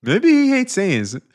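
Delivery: hammed-up high alien villain; Frieza impression. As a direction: savour it like a cartoon tyrant. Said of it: "my best Frieza impression".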